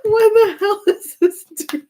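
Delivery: nearly crying with laughter; laughing hard. Real, not acted. Reader repeating herself, now helpless with laughter.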